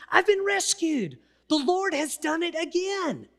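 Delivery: high, wondering voice of sudden relief; amazed realization. Acted. Peter realizing he's been rescued, voice lifted in wonder. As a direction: lift it up as if just waking to freedom. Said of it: "he realizes".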